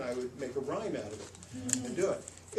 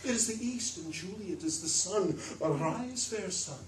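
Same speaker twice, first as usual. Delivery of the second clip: reciting poetry; 5 semitones higher than usual. Shown as a demonstration. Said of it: "as you're reciting poetry".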